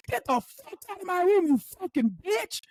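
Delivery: high, furious patient imitation; mock yelling. Patient throwing therapist out of his room, mock rage. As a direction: cuss someone out in a high mock yell, stretching the end. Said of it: "Please don't yell at me".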